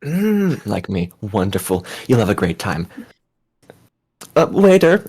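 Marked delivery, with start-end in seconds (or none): 4.4-5.0 raised, high call to a waiter. Acted. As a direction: hail the waiter across the room. Character calls the waiter over.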